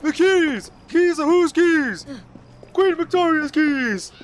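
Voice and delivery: high, drawn-out sentry call; guard imitation. Player mimicking the guards' exchange about the keys, high ceremonial shouting. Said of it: "see how that guard responded".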